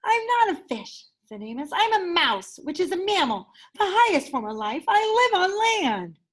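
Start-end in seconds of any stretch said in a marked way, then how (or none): 0.0-1.1 higher, indignant little mouse. Acted. Mouse Amos voice. 1.7-6.2 higher, indignant little mouse. Acted. Mouse Amos voice.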